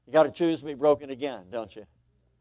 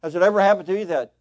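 ordinary delivery

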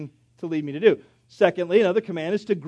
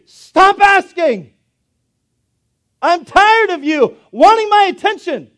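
much louder, higher and slow snap; irritated God; 10 semitones higher, 10 dB louder, slower than usual. Pretend. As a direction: rebuff someone who won't stop asking. Imagined God rebuffing.

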